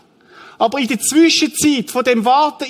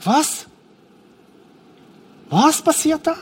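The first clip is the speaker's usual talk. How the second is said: higher-pitched, baffled question; astonished bystander; 6 semitones higher than usual. Acted. Onlookers at the grave hearing the stone must go, astonished. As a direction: act a startled onlooker asking what is happening.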